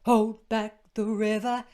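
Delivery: still loud. Shown as a demonstration. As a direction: keep the volume up. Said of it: "We're still up".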